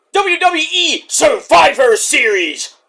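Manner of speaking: loud, drawn-out announcer shout; big wrestling-show intro. Acted. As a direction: belt out the event title slowly.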